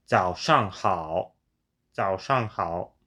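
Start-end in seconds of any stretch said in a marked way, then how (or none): 0.0-1.4 slow 早上好 with a careful third-tone dip; Mandarin tone demo. Shown as a demonstration. Learner demonstrating 早上好 for viewers, slow careful tones. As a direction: say 早上好 slowly, letting each third tone sink. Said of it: "tell me how much of an uptick you hear".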